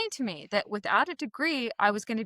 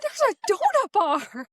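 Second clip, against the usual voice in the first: excited; 11 semitones higher than usual. Retold or imitated. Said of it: "And I was like".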